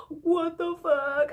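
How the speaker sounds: slow, high-pitched, drawn-out shock; re-enacted bewildered whine; stunned disbelief. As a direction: drag it out, aghast. Stunned at officers' orders.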